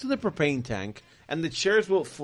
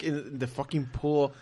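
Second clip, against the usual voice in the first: ordinary talk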